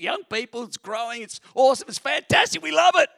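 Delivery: loud, high, excited gush. Acted. Pastor pitching the church to partygoers, excited gushing. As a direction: belt it out with huge enthusiasm.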